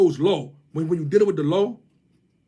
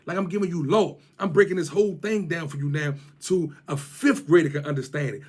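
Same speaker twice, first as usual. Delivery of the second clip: normal talking voice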